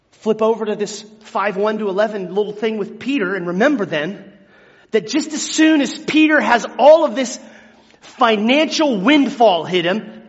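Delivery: usual speaking voice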